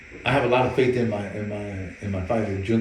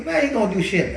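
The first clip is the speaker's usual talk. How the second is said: whiny brother voice; 10 semitones higher, 5 dB louder than usual. Retold or imitated. Quoting brother's complaint.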